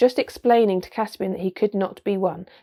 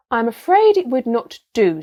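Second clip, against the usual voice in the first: firm, grave refusal; solemn and louder; 4 semitones higher, 7 dB louder than usual. A narrator's reading. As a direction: refuse with kingly seriousness. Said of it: "said Peter very gravely".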